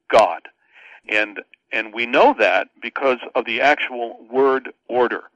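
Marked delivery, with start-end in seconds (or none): 0.1-0.4 "God" set apart and hit louder; pause, then land firmly on "God"; stress on "God". Shown as a demonstration. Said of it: "the real focus of the third clause is".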